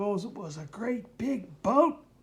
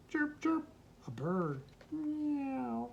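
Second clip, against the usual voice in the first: plain reading voice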